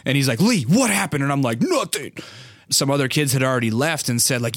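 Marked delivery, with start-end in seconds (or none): 0.4-1.2 high, urgent questioning, imitated; mock teacher demand. Gym teacher asking Lee about it, urgent. 1.6-2.1 high, pinched mock-tearful denial; fighting off tears. Wedgied kid answering the gym teacher, fighting off tears. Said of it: "trying to fight off the tears".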